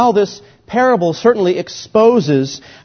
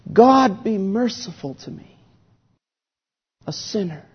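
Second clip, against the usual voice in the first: pleading prayer; 3 semitones higher than usual. Acted. Scripture reading of a plea.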